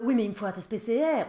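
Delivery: retelling the son's whiny plea; teen son whining. Retold or imitated. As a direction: play the son pushing his case. Son asking, mother retelling.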